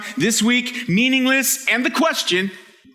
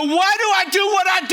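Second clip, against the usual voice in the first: high-pitched, impassioned cry; coming on strong; 8 semitones higher than usual. Acted. Preacher voicing someone brought to nothing, coming on strong. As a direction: cry it out like someone at the end of themselves. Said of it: "He's coming on strong".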